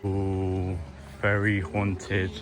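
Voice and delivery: slow spooky drawl. Pretend. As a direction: say it like a ghost story. Spooky remark on garden.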